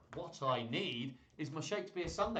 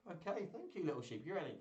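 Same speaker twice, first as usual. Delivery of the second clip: ordinary delivery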